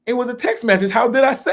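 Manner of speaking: high, loud exasperated laughing protest. Retold or imitated. Arguing with a partner over a text tone, high and laughing. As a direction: throw up your hands laughing.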